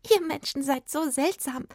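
high, amused fairy voice; high-pitched, tickled little creature; giggly little fairy. A narrator's reading. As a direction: tease as a tiny giggling fairy. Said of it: "kicherte die Fee".

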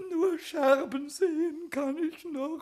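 tearful goblin whimper, slow and drawn out; whining lament. Acted. Goblin lamenting his broken bauble to the carpenter in tears. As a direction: sob out the woes of a self-pitying child. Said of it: "hör auf mit der Jammerei".

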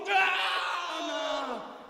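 kurzer, hoher Schrei; aufschreien, als man gepackt wird; hoher Angstschrei. Acted. Der Bär packt ihn. (hoher Schrei „Ah!“)

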